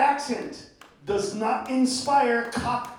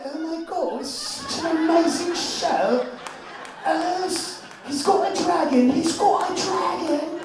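high, excited swooning fan; swooning woman; 8 semitones higher, 8 dB louder than usual. Retold or imitated. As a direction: gush like a smitten fan. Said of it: "unless they spoke like this".

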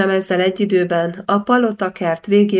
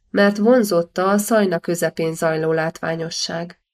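ordinary delivery, nothing marked

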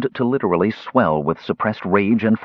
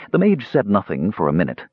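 ordinary delivery, nothing marked

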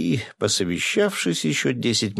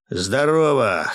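drawn-out, higher hailing call; 5 semitones higher, 4 dB louder, much slower than usual. A narrator's reading. Driver greeting guards from the car, called out. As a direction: hail them with a long, raised greeting. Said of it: "крикнул Костя".

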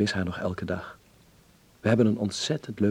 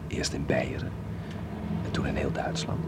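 whisper; 6 semitones lower, 6 dB quieter than usual. Acted. Whispering in the Reichstag.

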